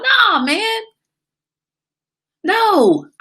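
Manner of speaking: emphatic no. Real, not acted. Speaker refusing to hand out numbers, high loud protest. Rejecting a passed-on phone number.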